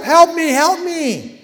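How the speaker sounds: high, loud, slow drawn-out cry upward; yelling for help. Retold or imitated. Man dangling from a cliff branch yelling up to the sky, loud slow panicked cry. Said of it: "And he yelled up".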